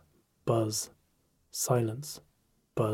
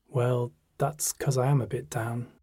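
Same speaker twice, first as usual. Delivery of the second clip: plain reading voice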